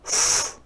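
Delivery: a long unvoiced "f" hiss through the teeth; voiceless f sound. Shown as a demonstration. Teacher showing children the "f" sound. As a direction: blow out a breathy "f" sound with no voice behind it. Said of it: "F says".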